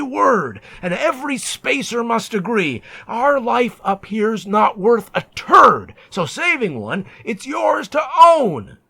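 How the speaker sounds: high, loud, bursting into shouts; bully him down with shouting; brutal shipmates' rant. Acted. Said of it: "They slashed my wrist and smashed my nose".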